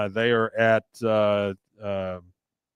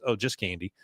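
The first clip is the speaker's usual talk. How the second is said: flat reply; 3 semitones lower, 8 dB quieter than usual. Retold or imitated. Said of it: "She goes".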